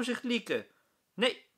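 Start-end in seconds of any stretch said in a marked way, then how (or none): 1.1-1.5 high, loud refusal; firm no. A narrator's reading. Meester Jaap refuses the class, firm and high. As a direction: cut them off.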